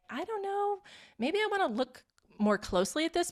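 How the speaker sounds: lightly acted, high self-questioning; tentative inner voice. Voicing people's hesitant inner knowing.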